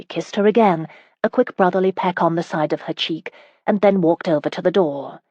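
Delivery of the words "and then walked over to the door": usual speaking voice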